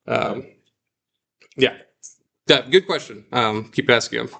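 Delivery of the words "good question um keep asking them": ordinary talk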